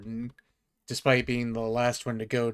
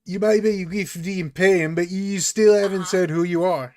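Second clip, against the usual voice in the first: loud, higher guard insisting; stern guard; 7 semitones higher, 8 dB louder than usual. Acted. Guard pressing the stranger, loud and stern. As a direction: press them for an answer. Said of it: "they say".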